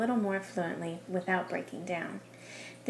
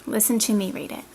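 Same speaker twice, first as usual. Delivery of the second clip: ordinary talk, nothing marked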